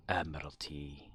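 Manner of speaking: soft unvoiced whisper to self; quiet whispered aside. Real, not acted. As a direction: breathe the word out to yourself. Whispers the game mode name.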